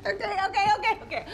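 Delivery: laughing surrender to her partner. Acted. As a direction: give in through helpless laughter. Couple joking and laughing together.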